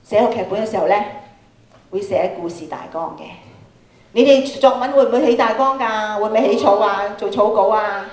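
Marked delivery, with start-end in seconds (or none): none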